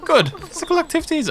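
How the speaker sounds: very high put-on female voice; exaggeratedly high mock woman. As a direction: squeak it out as a falsetto woman. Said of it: "try to do a woman voice".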